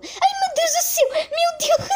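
high panicked cry; panicked squeal. Real, not acted. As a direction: squeal in fright. Panicked escape in game.

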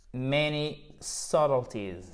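many subtleties, slow and soft, said SUT-ul-teez; silent B demo. Shown as a demonstration. Teacher dictating the sentence while showing three consonants, silent b. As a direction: draw out many subtleties, leaving the b unsounded. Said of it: "Le B, vous ne le prononcez".

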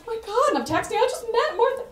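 starstruck squeal. Retold or imitated. Retelling texting friends after meeting Martha, giddy squeal. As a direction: gush like a fan who just met her idol.

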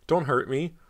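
high, loud, scared plea; begging. A narrator's reading. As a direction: plead to be spared. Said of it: "Cody begged as the ghost floated nearer".

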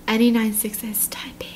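soft breathy unvoiced whisper. Shown as a demonstration. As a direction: hush it like a secret in the dark. Whispers eerie typing notice.

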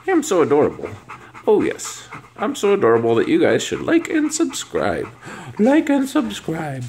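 high cutesy voice, slowing at the end; dog's voice. Pretend. Owner voicing her dog asking viewers to like and subscribe. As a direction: play the adorable dog begging for subscribers.

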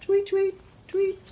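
very high, quick chirps; bird tweet. Pretend. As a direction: chirp "tweet" like little birds. Birds in picture book.

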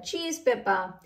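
ordinary delivery, nothing marked

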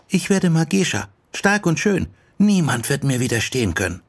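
put-on high, proud girlish declaration; boastful girl. Yoko tells her friend her dream, boastful.

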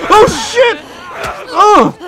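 very high, loud curse; real shock, voice leaping up; startled yell. Real, not acted. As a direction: shriek at a sudden attack. Sudden attack in game.

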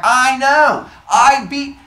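loud, high, cut-off protest; exasperated. Acted. Athena answering the pointing crowd, loudly exasperated. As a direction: burst out over their pointing. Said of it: "and she's like".

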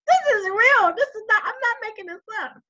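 high, loud, laughing as she swears it's true; cracking up while insisting it's real; laughing insistence. Real, not acted. Telling her audience the story is true, laughing loudly.

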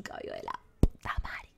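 unvoiced aside; whispered compliment. Real, not acted. Streamer compliments stranger's hair, whispering. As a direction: whisper it as a secret.

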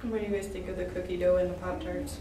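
eating snack while speaking; chewing while talking. Real, not acted. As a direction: mouth partly full of food. Seen 0: Small wide shot; all three eat; speaker unclear, likely chewing while asking.

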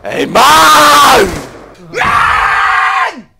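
loud angry gamer yell; frustrated yell. Real, not acted. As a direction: yell at the screen. (wütender Schrei)

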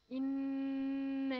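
إنا stretched on the long vowel; إنا with a long drawn-out madd. Shown as a demonstration. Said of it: "لازم تمد زي ما أنا بمد".

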